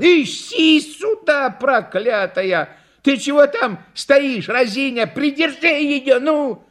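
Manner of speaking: high-pitched, exasperated scolding; cross shouting. A narrator's reading. Clown calls back his dog, scolding shouts. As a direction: berate the runaway dog and idle helper. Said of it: "закричал клоун".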